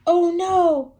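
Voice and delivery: very high, loud cry; frightened shout. Acted. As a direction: yell it in terror. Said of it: "whispered Hilda".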